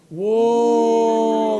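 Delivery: long drawn-out whoa; drawn-out stunned exclamation; impressed whoa. Real, not acted. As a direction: stretch the whoa out in reaction. Reacts to NASA's put-down.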